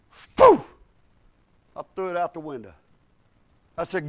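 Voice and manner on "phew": whoosh of a toss. Retold or imitated. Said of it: "and I went".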